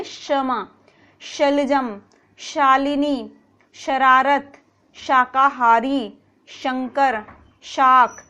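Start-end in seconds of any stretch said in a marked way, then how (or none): 0.0-0.7 क्षमा softly with sh; correct sh. Shown as a demonstration. Teacher giving the right sh in क्षमा. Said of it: "हमें क्या बोलना है". 4.9-6.2 शाकाहारी drawn out slowly with sh; slow careful sh. Shown as a demonstration. Teacher modelling शाकाहारी slowly. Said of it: "उच्चारण करके देखें".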